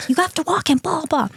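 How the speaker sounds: high, insistent nagging; nagging helicopter mom. Retold or imitated. Daughter nagging her parents to walk the dog, high nag. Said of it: "And I'm like".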